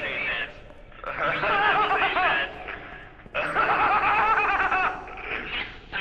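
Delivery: extended squealing laughter; long high laugh. Real, not acted. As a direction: laugh till breathless.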